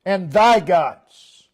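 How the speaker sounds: thy drawn out slowly and stressed. Shown as a demonstration. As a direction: lean slowly on the word thy. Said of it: "and then notice".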